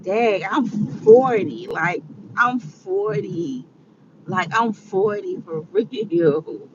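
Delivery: slow, drawn-out dazed realization; slow disbelief. Retold or imitated. Vlogger quoting her own stunned thoughts about turning forty, slow. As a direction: let each "I'm forty" sink in slowly. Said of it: "I'm like".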